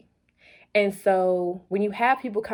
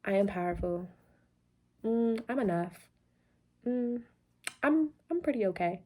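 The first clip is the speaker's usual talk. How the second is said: much softer, doubtful and hesitant; half-hearted; 7 dB quieter than usual. Shown as a demonstration. Coach showing the weak way to affirm, softer and unsure. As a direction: trail off like you don't believe it.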